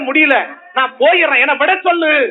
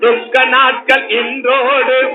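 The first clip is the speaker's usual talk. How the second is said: very slow, drawn-out chant; slow prophetic invitation; much slower than usual. Acted. Preacher inviting the afflicted to come and believe, slow chant. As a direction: call them gently toward the light.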